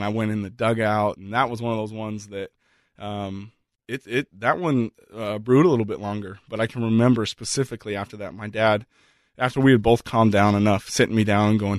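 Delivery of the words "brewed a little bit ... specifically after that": usual speaking voice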